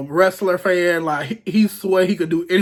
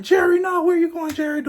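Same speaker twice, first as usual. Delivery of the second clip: high call after him; mock pleading call; 12 semitones higher than usual. Narrator calling after cousin Jerry, high pleading.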